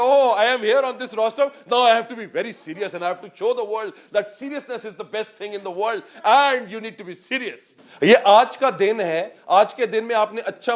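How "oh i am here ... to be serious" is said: higher, slow, self-important podium voice with a laugh breaking through; mock a stiff speaker on the rostrum; pompous serious speaker. Trainer mocks speakers who turn solemn at the rostrum, hammed-up pomposity. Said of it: "तो everybody".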